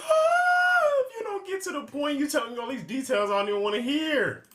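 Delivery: high, loud yelp of frustration, then ranting; exasperated outburst. Real, not acted. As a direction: blow up at the storyteller for dragging it out. Reactor fed up with details.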